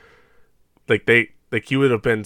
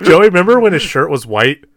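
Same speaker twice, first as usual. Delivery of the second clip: louder mock question; 7 semitones higher, 9 dB louder than usual.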